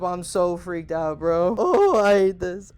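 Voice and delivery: ordinary talk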